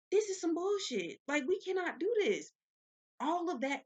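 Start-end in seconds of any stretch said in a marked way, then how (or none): 0.1-2.5 higher, louder outraged outburst; fed up, pitch it up; outraged thought. Retold or imitated. Worker thinking about unfair pay, higher outraged tone. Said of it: "I'm thinking to myself".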